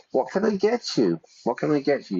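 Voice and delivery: lightly voiced cheery bartender, high; waiter asking. Bartender greeting a customer walking into the pub, high and cheery. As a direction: ask it like a friendly barman at the bar. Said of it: "The waiter or waitress might say to you".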